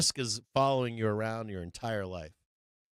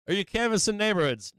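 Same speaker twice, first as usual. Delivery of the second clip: higher, raised-voice grilling; ranting interrogation; 8 semitones higher, 5 dB louder than usual. Acted. Streamer grilling internet complainers, ranting interrogation. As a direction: fire off loud rhetorical questions. Mock interrogation of online critics.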